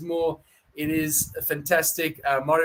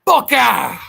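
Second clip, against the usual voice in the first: a fan's proud rallying yell; rousing cheer; 8 semitones higher, 11 dB louder than usual. Real, not acted. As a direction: belt it out, high and loud. Cheering on his team at sign-off.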